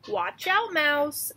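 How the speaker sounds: loud, high urgent warning; warning uncle. Acted. Uncle mouse warning his nephew, loud and urgent. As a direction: call out to stop him in time. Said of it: "said his uncle".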